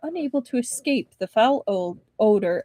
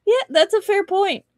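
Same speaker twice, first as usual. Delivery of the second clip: usual speaking voice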